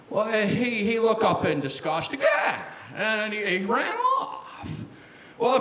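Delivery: folksy, hesitant leading-man voice; Jimmy Stewart. Pretend. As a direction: tell it like a stammering old film star. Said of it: "I like to do it as Jimmy Stewart".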